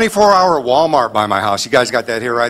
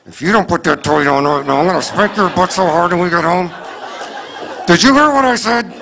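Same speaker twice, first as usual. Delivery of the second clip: ventriloquist mom threat; 2 semitones higher than usual. Retold or imitated. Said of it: "you do that ventriloquist thing".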